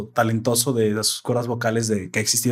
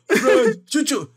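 sneezed name; 11 semitones higher, much slower than usual. Retold or imitated. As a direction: say it like a sneeze, slow and high. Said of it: "pero estornuda".

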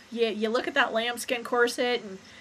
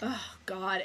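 normal talking voice

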